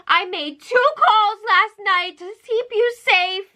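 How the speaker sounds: hammed-up weepy voice, pitched high; mock tearful. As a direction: wail it slowly as a melodramatic imitation. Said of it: "between the tears".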